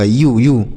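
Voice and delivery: यू drawn out as a clear long "yoo", said twice; teacher modelling यू as "yoo"; model pronunciation. Shown as a demonstration. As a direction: say यू slowly and cleanly as "yoo" for pupils. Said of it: "ऐसे आपको उच्चारण करना होगा".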